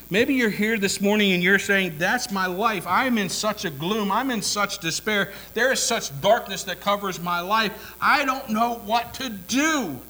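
loud impassioned preaching; preaching at full force. Real, not acted. Preacher voicing the despairing listener at full force. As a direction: pour it out to the congregation.

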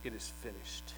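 barely audible, breathy final words; dying last words. Acted. Preacher voicing dying Jesus on the cross, faint and spent. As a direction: let it fade out like a last breath.

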